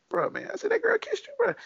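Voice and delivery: high, stunned disbelief; asking the coworker in high-pitched disbelief; incredulous question. Retold or imitated. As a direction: squeak it out in pure disbelief.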